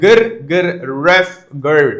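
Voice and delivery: slow mock growls and a ruff; imitated angry dog. Shown as a demonstration. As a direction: growl like the neighbour's chained dog. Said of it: "The neighbor's angry dog". (ദേഷ്യം പിടിച്ച നായയുടെ മുരൾച്ച അനുകരിച്ച്)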